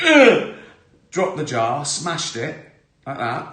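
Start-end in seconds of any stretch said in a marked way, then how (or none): 0.0-0.5 sudden high shriek of disgust; retold disgusted shriek. Recalling the spider on his arm, a high shriek. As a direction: shriek like a spider just ran up your arm. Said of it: "I went".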